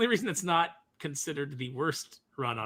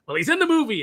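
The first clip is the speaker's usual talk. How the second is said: high and loud, selling it; excited pitch; 11 semitones higher, 9 dB louder, faster than usual. Retold or imitated. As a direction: announce it like great news. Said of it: "they'd be like".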